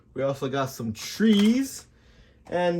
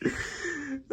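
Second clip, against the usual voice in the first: laugh; 12 semitones higher, 12 dB quieter than usual. Real, not acted. Laughs at own swearing.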